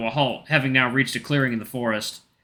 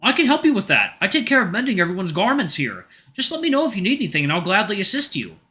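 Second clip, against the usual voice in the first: high, friendly bear voice; cheerful sun bear; 8 semitones higher than usual. Acted. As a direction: greet him brightly, pitched up. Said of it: "Ridley offers help".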